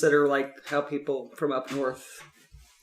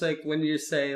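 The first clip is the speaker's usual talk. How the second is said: ordinary delivery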